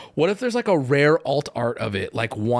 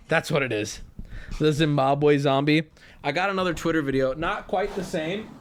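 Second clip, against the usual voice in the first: ordinary delivery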